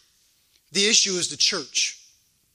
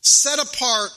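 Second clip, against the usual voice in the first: deliberate, emphatic scripture proclamation; measured preacher cadence with weight; preaching; 4 semitones higher, 4 dB louder than usual. Real, not acted. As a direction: read it out from the pulpit, stretching key words. Reads and expounds scripture.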